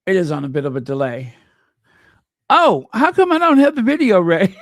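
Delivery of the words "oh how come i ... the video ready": loud, high burst of dismay; real flustered outcry, pitched up; alarmed exclamation. Real, not acted. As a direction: blurt it out in a sudden fluster. Sudden panic over missing video.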